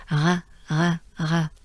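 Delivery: "R" as a throat sound with short vowel; short repeated French "R", sounded "re" in the throat. Shown as a demonstration. Teacher recalls the sound just worked on, French R. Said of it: "the French R".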